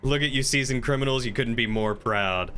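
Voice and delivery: ordinary talk, nothing marked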